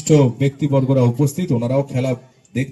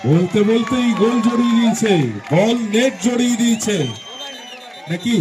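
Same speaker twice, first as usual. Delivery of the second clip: high excited goal call; frantic football commentary; 7 semitones higher than usual. Real, not acted. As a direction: shout it's in the net. Commentator thinks goal scored. (উত্তেজিত গোলের ঘোষণা)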